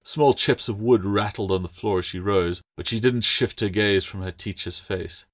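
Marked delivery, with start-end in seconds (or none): none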